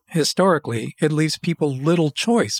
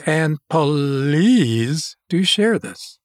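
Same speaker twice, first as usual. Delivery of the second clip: drawn out, pleading slowly; much slower than usual. Real, not acted. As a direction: stretch every word as you beg. Drawn-out plea to share.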